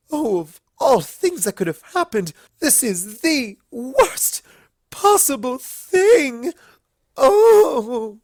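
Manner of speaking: high, loud, slow, building to a cry; theatrical despair before fainting; voiced Rarity wailing; dramatic Rarity wail. A narrator's reading.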